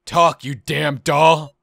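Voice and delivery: loud, slow furious order; angry demand. Acted. Player angrily demands answers from the doll. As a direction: bite down on every word.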